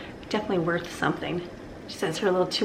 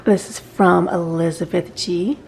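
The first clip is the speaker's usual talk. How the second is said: usual speaking voice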